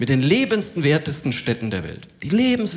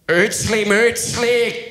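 mock Swiss German incantation, pitched up; Swiss German mumble; 8 semitones higher, 4 dB louder, much slower than usual. Neighbour greets comedian in mock Swiss German incantation. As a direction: chant it like strange Swiss German. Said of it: "sagt er so irgendwas auf Schweizerdeutsch".

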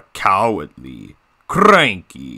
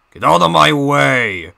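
high, loud villain shout; Diesel 10; 6 semitones higher, 5 dB louder than usual. Acted. Villain diesel ordering others aside, loud raised shout. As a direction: bellow it as a bullying villain. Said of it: "doing my own Diesel ten voice impression".

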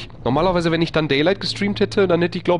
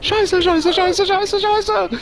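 very high, frantic cursing; panisches Fluchen; much slower than usual. Real, not acted. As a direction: swear repeatedly in a squealing panic. Panicked swearing under attack in game. (panisch und schrill fluchen)

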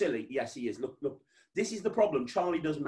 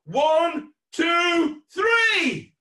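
loud, high count, climbing each number; shouted countdown; 12 semitones higher, 9 dB louder, much slower than usual. Real, not acted. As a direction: count the crowd in at full volume.

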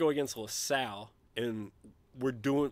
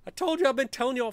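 high, louder I told you, stress on you; yelling I told you at doubters; exasperated shout; 11 semitones higher, 4 dB louder, faster than usual. Real, not acted. Fan telling everyone he was right, exasperated shout. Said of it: "repeated I told you, then shouted".

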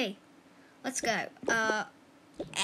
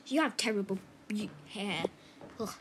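ordinary talk, nothing marked

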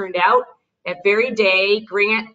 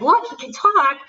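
higher-pitched, delighted show-off; proudly showing off; 7 semitones higher than usual. Retold or imitated. Mother showing her sister that he can talk, showing off. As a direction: show him off like a little kid. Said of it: "showing off, just like you would a little kid".

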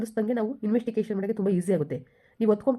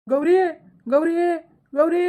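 calls rising to a high loud cry; husband shouting for his missing wife in panic; desperate calling; 7 semitones higher, 4 dB louder, much slower than usual. Acted. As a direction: shout her name, growing frantic.

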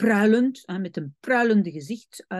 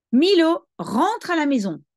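raised, louder call on the name, then easing; motherly command; 7 semitones higher, 5 dB louder than usual. A narrator's reading. Mother sending Milo into the house, commanding. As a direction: read a mother's order, calling the name firmly. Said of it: "Lui intima sa mère".

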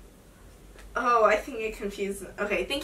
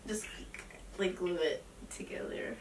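whisper; 4 semitones lower, 11 dB quieter than usual. Acted. Muttered aside on prank call.

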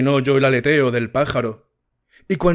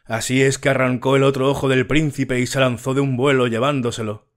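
ordinary delivery

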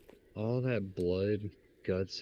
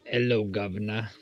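cheeky Cockney greeting; mock British accent; 4 dB louder than usual. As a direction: greet in a put-on London accent. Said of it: "What accent should I do?".

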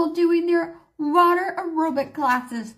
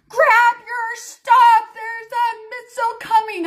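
imitated screaming; 8 semitones higher, 5 dB louder than usual. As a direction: act out a husband yelling an urgent warning. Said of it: "I hear my husband screaming".

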